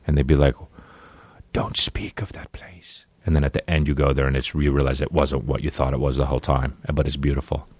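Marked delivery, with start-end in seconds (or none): 1.5-3.0 slow, hushed whisper of a warning; spooky whisper. Retold or imitated. Whispering a dark warning about Orillia. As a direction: breathe it like a forbidden secret. Ominous Narnia-style warning.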